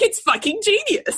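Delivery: giddy delighted laugh on the words. Real, not acted. Mother gushing about her daughter's cheek, laughing.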